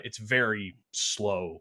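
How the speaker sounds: It's very slow, drawn out slowly; slowed for effect. Shown as a demonstration. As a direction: stretch out very slow.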